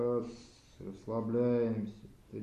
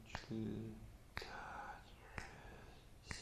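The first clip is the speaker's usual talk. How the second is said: fading to a whisper; 14 dB quieter than usual. Real, not acted. Counting fades to whisper.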